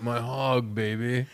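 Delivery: soft mock cool-guy voice; imitating boastful boyfriend. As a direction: drop the volume for a smug mock come-on. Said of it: "this guy being like".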